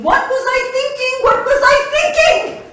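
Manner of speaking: builds from a cry to a loud laughing wail; exasperated outburst. Acted. Rising self-reproach, repeated.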